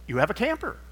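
high, rising pounce; mock surprise. Seller learns buyer has a camper, mock surprised. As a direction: seize on the new find with pretend surprise.

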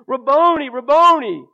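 Mary recognizing her teacher, crying out jubilantly; jubilant exclamation. Acted. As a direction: cry it out in sudden joyful recognition. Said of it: "with an exclamation, with excitement, with jubilation".

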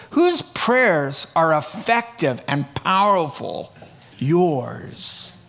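rally the congregation with each question; preaching at full force. Real, not acted. Preacher firing questions at the congregation, fervent.

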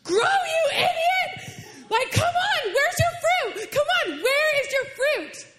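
high-pitched drawn-out yell at a plant; mock yelling. Preacher demanding fruit from a tomato plant, high mock yelling. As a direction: berate it in a very high, exaggerated yell. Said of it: "just yelling at it".

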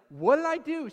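high, loud mock protest; acted-out indignant bewilderment; bewildered husband. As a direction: plead innocence loudly, baffled. Retold husband protests wife's accusation.

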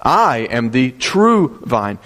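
slow, "I" and "true" each stretched; measured line with weight on "I" and "true"; stress on I and true. Shown as a demonstration. Preacher repeating the verse to congregation, stress on "I" and "true". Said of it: "You would emphasize the word".

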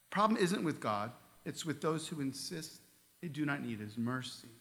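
ordinary delivery

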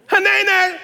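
high ringing call; proclaimed. Shown as a demonstration. Repeated proclamation.

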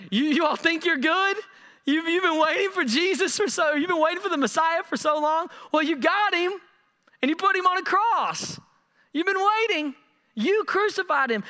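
high, loud, in-your-face calling out; bold rebuke. Acted. Preacher as Peter confronts the crowd, high and loud. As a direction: throw it in their faces. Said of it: "I'm like".

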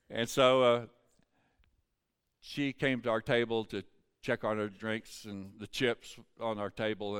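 usual speaking voice

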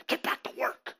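harsh unvoiced whisper yell. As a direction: hiss it like a furious shout. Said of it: "the whisper yell at me".

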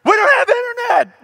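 high, loud mock yell of exasperation; fed-up dad yelling at his daughter that the internet is down; exasperated shout.

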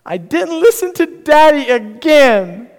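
mock whine of a frustrated kid; frustrated crying child. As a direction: imitate a small son's exasperated confession. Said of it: "You hear that frustration?".

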